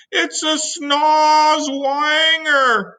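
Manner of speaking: very slow, high drawn-out cry; frightened cry. Acted. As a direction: stretch the monster name in terror. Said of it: "cried the police chief".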